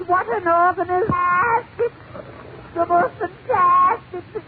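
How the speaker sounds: very high-pitched, drawn-out wonder; hammed-up awestruck newcomer; amazed Irish immigrant. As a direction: marvel in a high, monotone comic wail. Irish immigrant marvels in joke.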